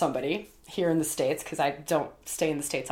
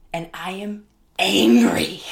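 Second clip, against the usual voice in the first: intense whisper; 7 dB louder than usual. Retold or imitated. Retold anger, slow intense whisper.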